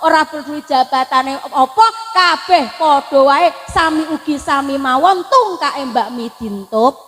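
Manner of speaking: normal talking voice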